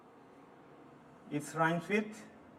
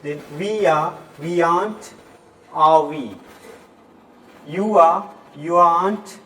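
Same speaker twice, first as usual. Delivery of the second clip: loud classroom drill; 8 dB louder than usual. Acted. Teacher drills be verbs aloud.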